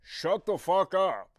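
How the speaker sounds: fed-up driver yells down the bus; bus driver snapping. Retold or imitated. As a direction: shut her up with one loud yell. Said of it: "the bus driver just goes".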